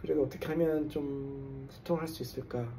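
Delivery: normal talking voice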